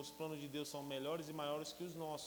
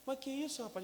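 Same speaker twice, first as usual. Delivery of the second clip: surprised director; 10 semitones higher than usual. Retold or imitated. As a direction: react in disbelief.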